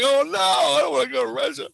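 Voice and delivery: high, loud wail of protest; hammed-up pleading not to be taken; mock dismay. Acted protest at being taken away.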